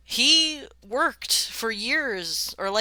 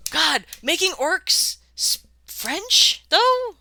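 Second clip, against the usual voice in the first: excited; 10 semitones higher, 6 dB louder, slower than usual. Real, not acted. Excited at French orcs idea.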